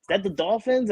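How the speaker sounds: lightly voiced curious little girl; imitating little daughter. Dad mimicking his daughter during a Patriots game, curious. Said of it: "and she goes".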